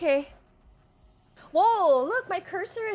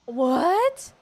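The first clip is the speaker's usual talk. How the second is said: surprised; 5 semitones higher, 6 dB louder than usual. Real, not acted. Stunned by a game twist.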